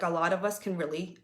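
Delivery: ordinary talk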